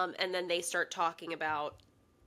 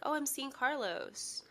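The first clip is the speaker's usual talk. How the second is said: mildly giddy, higher and softer; imitated excitement; 6 semitones higher, 5 dB quieter than usual. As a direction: play at excitedly sharing gossip. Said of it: "like, excited to tell Gabby".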